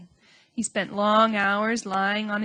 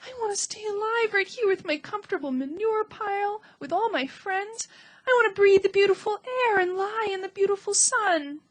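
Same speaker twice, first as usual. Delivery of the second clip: tearful lament; 10 semitones higher than usual. A narrator's reading. Wilbur laments his life.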